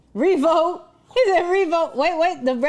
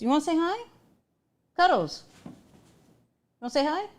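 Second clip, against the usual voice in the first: higher, softer coaxing question; coaxing the dog; 6 semitones higher, 4 dB quieter, faster than usual. Real, not acted. Owner coaxes dog Cuddles to greet viewers, higher and softer. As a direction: sweet-talk a pet to come say hi.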